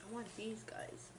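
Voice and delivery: soft whispered aside. Real, not acted. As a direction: whisper it to yourself over the food. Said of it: "whispering over food".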